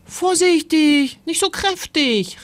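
viel höher, aufgeregt warnend; besorgter Ruf. Acted. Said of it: "rief Timon". (aufgeregt warnend, höher)

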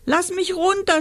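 high, tearful begging; sobbing plea. A narrator's reading. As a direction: plead as if sobbing. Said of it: "schluchzte Rosa".

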